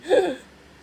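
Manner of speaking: high startled gasp; sharp gasp. A narrator's reading. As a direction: gasp in joyful disbelief. Prince's giddy disbelief.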